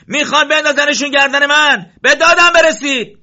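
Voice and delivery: frantic high shouting for help; panicked yelling. Acted. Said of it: "شروع کرد به داد و بیداد".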